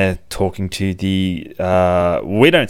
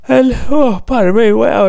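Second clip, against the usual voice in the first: words stretched through a big yawn, much higher; 7 dB louder than usual. Real, not acted. Reviewer yawning mid-sentence, caught by surprise. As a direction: talk through a real yawn. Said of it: "I did a yawn".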